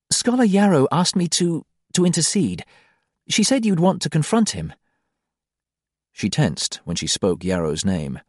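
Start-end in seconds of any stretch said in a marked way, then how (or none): 0.1-4.7 lightly voiced woman, higher, amused; a woman gently breaking news, higher; wife explaining the new world, higher, amused; Ziri, higher voice. A narrator's reading.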